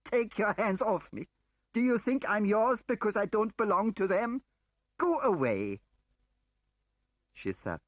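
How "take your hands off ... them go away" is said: high, loud furious scream; screaming in fury. A narrator's reading. Woman screaming at Smiley to let go, high and loud. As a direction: shove him away with the words. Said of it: "began screaming at him".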